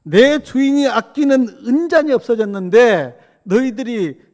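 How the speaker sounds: high, loud acted scolding; stern rebuke. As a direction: order a reprimand, loud and high. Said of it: "그러면서 책망하라 그래요".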